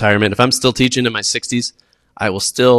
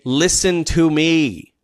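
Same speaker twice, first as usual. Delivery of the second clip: slow teacher command; 5 semitones higher, much slower than usual. Shown as a demonstration. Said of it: "Don't say".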